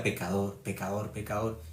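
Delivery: quietly mimicking his old self-condemnation; self-condemning murmur. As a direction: mutter it to yourself in shame. Preacher recalls calling himself sinner.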